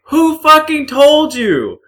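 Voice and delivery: high, loud, drawn-out incredulous yell; loud exasperated shriek; outraged. Acted. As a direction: explode in mock outrage, stretching it out. Acted outrage at the nosy remark.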